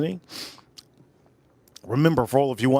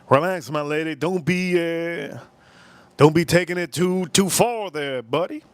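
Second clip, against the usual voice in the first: usual speaking voice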